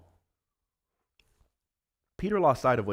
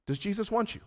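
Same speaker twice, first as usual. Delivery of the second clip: ordinary talk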